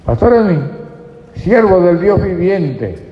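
mock-grand herald calling out his name; grand proclamation. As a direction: proclaim the title proudly. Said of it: "si a mí me llamaran así".

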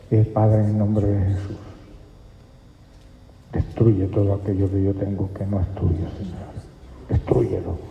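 soft, earnest retold prayer; hushed prayer. Preacher retelling how he prayed quietly by the fire. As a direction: pray under the breath, pleading.

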